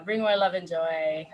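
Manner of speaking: ordinary delivery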